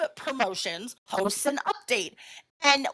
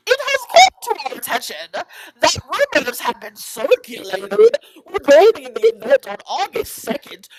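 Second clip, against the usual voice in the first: louder, haughty official statement; snooty accent; 3 dB louder than usual. Retold or imitated. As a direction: read it like a stuck-up press release. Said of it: "I'm gonna be reading it in a snooty accent".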